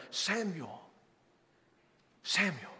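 a still small voice calling; soft calling voice. Acted. The Lord calls Samuel.

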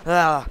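short loud yelp of real fright; startled yelp. Real, not acted. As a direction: jump and yelp as the beast appears. Startled by the alligator.

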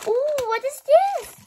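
high, thrilled ooh of discovery; delighted squeal at a new find; excited. Real, not acted. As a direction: gasp it out as if you found treasure. Delighted discovery while unboxing.

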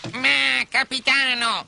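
high-pitched pirate objection; startled protest. Acted. Pirate protests to his captain.

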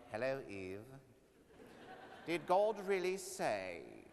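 slow, low British serpent voice, sly and sinister; a British villain snake purring at Eve; evil British snake. Acted. Snake tempting Eve, in a slow evil British accent. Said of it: "British, evil".

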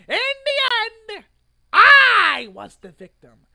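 loud, high indignant protest; loud self-defending rant about the arrest. Real, not acted. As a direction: insist loudly you were wronged. Ranting defense of own actions.

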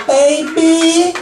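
put-on high, teasing girlfriend voice. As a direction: girlfriend calling from the next room, high and teasing. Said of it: "I'd hear".

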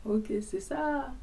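high, soft, drawn-out mock approval; unimpressed spectator. Seated onlooker watches his proud dancing, slow drawn-out reaction. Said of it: "là, en mode".

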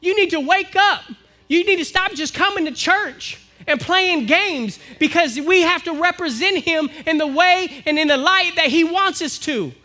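impassioned sermon climax; preaching at full force. Acted. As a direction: drive the message home to the congregation.

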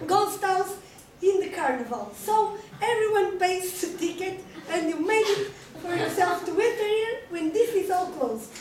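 loud scolding tirade, a laugh creeping in; angry big mouse. Acted. The big mad mouse scolding the intruder, loud and angry. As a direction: tell off the trespasser, loud and exasperated. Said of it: "a big mad mouse".

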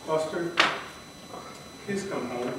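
acted sorrowful murmur; sad plea. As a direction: quietly beg a lost pet to return. Softly begging lost cat home.

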